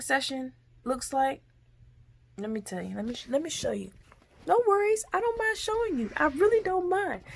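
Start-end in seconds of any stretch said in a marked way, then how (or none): 2.4-7.2 high, soft sugary offer; sweetly menacing, softly; mock sweet voice.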